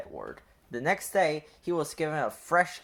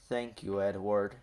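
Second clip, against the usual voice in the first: softer, lower thanks with a smile; smiling thanks; 6 semitones lower, 4 dB quieter than usual. A narrator's reading. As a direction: thank him gently with a smile. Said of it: "smiled Henry".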